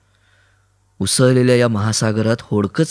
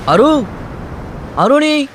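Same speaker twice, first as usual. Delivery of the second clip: calling out; 13 semitones higher, slower than usual. Acted. Voices calling his name.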